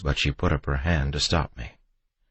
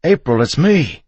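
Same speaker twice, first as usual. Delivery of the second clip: high, loud plea; desperate, raised yell; urgent shout; 13 semitones higher, 8 dB louder than usual. A narrator's reading. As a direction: shout to be recognised. Husband pleading with armed wife.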